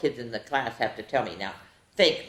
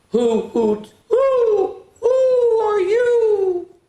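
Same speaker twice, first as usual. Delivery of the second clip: hooting owl; 9 dB louder, much slower than usual. Acted. Owl voice in riddle.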